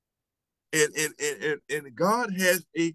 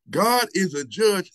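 ordinary talk, nothing marked

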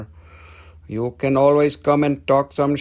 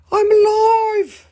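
high, loud, slow squeal; crystal saying I'm alive; 6 dB louder, slower than usual. Pretend. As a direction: let the crystal cry out it's alive, high and drawn out. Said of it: "it says".

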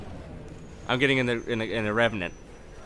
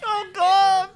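high, strained cry through laughter; laughing, choked squeal; helpless plea; 12 semitones higher, 4 dB louder than usual. Real, not acted. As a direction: beg for help with a mouthful. Choking on gum from laughing.

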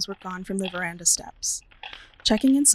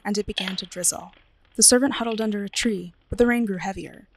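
ordinary delivery, nothing marked

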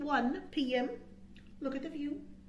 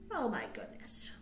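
breathy whispered aside; hushed wonder; 3 semitones lower, 10 dB quieter than usual. A narrator's reading. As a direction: whisper in suspense. Waiting hours, hushed aside.

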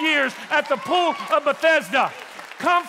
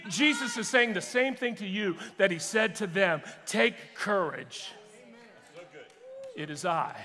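tender and hushed; 6 semitones lower, 7 dB quieter than usual. Real, not acted. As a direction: speak softly to anyone sinking. Preacher turns tender to congregation.

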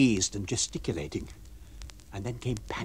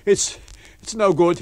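louder, higher, gasping between words; panting out the words; sobbing for breath; 6 semitones higher, 8 dB louder than usual. Acted. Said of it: "as if sobbing for breath".

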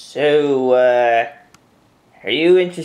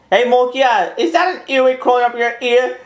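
teasing call; 8 semitones higher, faster than usual. Acted. Teasing friend with a prank.

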